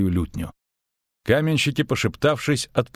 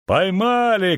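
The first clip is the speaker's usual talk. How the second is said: higher-pitched cry of triumph; raised-voice shout; shouted call; 8 semitones higher, slower than usual. A narrator's reading. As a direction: call it out high, as a catch announced. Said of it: "крикнули каменщики".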